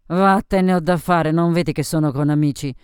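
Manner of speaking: loud, annoyed brush-off; boozy woman snapping at a pest; irritated dismissal. Acted. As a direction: send him packing sharply. Sophie sends the man away.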